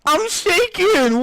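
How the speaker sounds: usual speaking voice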